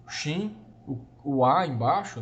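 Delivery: usual speaking voice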